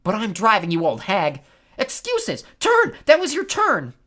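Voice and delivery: high, loud bickering hags; angry squabbling. A narrator's reading. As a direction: squabble loudly, voice pitched right up. Sisters squabbling over the eye.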